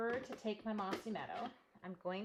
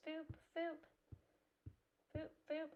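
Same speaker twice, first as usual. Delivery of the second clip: high, soft little boops; playful boop noises; 9 semitones higher, 7 dB quieter than usual. Real, not acted. Crafter sounding out dabs with the pad, playful boops. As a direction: make tiny high-pitched booping sounds. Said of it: "make noises".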